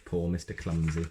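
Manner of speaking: plain reading voice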